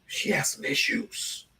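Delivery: hushed gossip whisper; voiceless confiding whisper; whispered aside. Real, not acted. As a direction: drop to a secret whisper. Gossiping aside about the woman.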